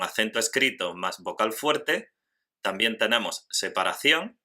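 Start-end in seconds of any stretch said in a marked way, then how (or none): none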